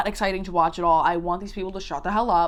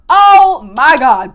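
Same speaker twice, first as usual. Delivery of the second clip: huge, drawn-out high shout; shocked outcry; 5 semitones higher, 16 dB louder, much slower than usual. Acted. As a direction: blast it out slowly in shock. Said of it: "In my notes, I have written here".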